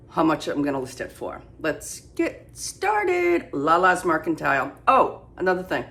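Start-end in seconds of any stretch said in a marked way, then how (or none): 1.6-3.5 sing-song. Real, not acted.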